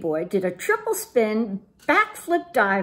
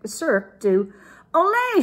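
high-pitched mock group shout; 8 semitones higher, 4 dB louder than usual. A narrator's reading. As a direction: shout it out like cheering amigos. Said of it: "shouted his amigos".

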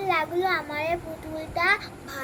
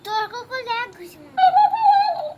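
mock threat; 6 semitones higher, 9 dB louder, slower than usual. Scolding the talking cactus toy.

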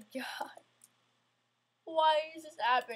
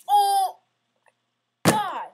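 sudden loud high cry of dismay; real startled shout; frustrated yelp; 7 semitones higher, 16 dB louder than usual. Real, not acted. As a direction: blurt it out as the jump fails. Frustrated gamer fails jump.

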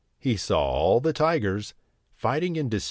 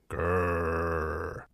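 tiger growl; 5 semitones lower, 6 dB quieter than usual. Acted.

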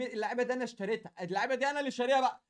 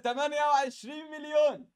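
louder excited shout of a number; 3 semitones higher, 3 dB louder than usual. Real, not acted. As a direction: announce the price with a burst of excitement. Excited at trade value.

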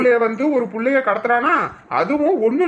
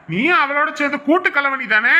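usual speaking voice

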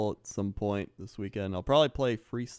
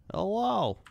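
silly hello; 10 semitones higher than usual. Pretend. Greeting in game voice.

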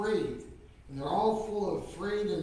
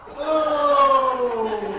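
loud gasp; 8 semitones higher, 14 dB louder than usual. Shown as a demonstration. Blowing into water demo.

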